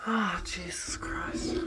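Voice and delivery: slow whispered sigh; exasperated whisper. Real, not acted. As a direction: whisper it under the breath. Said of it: "quit whispering".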